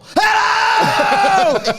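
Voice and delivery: high, long shriek; sudden loud scream. Acted. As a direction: blast out a startling scream. Earbud pops out, shrieking blares.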